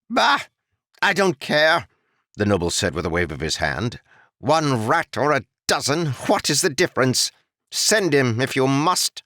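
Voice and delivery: high, scornful lord waving it away; dismissive noble. Acted. Noble dismissing the lumberman's request, high scornful voice. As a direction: brush the peasant off like a fly.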